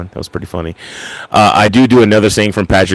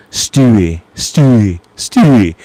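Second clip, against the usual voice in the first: Stewie; 2 semitones higher, slower than usual. Retold or imitated. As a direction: try a weird British cartoon baby voice. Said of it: "he's like".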